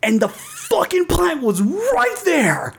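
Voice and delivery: high, drawn-out mock outrage; slow, high-pitched exasperation; exasperated disbelief. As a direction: stretch it out, high and incredulous. Exasperated retelling of the discovery.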